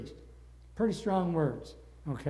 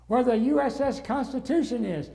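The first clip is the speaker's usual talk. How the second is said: louder and higher, pressing the point; mock frustrated call to the cabbie; exasperated explaining; 7 semitones higher, 6 dB louder than usual. Repeating louder to cab driver.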